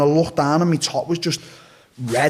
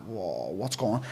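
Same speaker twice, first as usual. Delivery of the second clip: dazed mumble; 6 semitones lower, 10 dB quieter, slower than usual. Retold or imitated. Retold dazed moment after stabbing.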